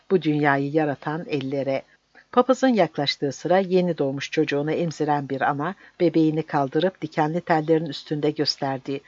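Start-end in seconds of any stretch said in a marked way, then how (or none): none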